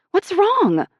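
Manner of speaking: high, loud alarmed question. A narrator's reading. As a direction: sound suddenly worried.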